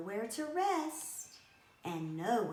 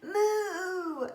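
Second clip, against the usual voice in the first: high loud cow moo; 11 semitones higher, 8 dB louder than usual. Acted. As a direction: belt out a cow's cry. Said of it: "the cow cries".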